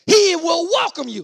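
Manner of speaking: slow, loud pulpit promise; preacher stretching out each word; emphatic preaching. Real, not acted. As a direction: proclaim it to the congregation.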